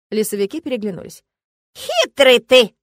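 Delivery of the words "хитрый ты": put-on very slow, creaking old man; creaky old man. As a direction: creak it out slowly. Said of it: "наконец проскрипел старший".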